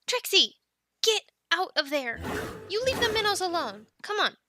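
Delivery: loud, high telling-off; scolding call. Acted. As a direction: call a naughty animal away. Scolding a misbehaving dragon.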